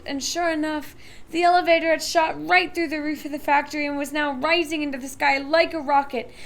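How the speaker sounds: ordinary delivery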